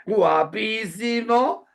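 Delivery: guapísimo drawn out high and slow with a rising tune; guapísimo said as an ironic drawn-out rise. Shown as a demonstration. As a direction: stretch guapísimo to sound sarcastic. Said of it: "e incluso en ese tono".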